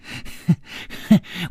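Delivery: soft breathy unvoiced giggle; a dreamy little laugh under the breath; besotted giggle. Gong Nanyan giggles dreamily over the bleeding woman. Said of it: "只听宫南燕痴痴地说".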